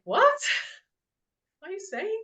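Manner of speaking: high, laughing "What?" of disbelief; retold disbelief. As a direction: replay your stunned laugh at his claim. Retold shock at psychic's words.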